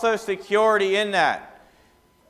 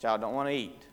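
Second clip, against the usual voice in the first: usual speaking voice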